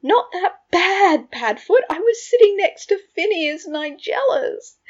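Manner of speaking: loud, high indignant echo; outraged. A narrator's reading. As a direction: throw the words back in disbelief. Outraged echo of friend's remark.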